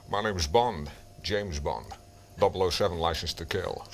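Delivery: deep, deliberate Bond impression; Sean Connery. Shown as a demonstration. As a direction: drop the voice right down, unhurried. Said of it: "they don't get the depth".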